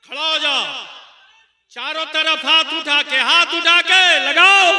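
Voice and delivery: high, loud call to stand and raise hands; rally the whole hall to its feet; rousing command. Real, not acted. Preacher ordering the crowd to stand and raise hands, loud.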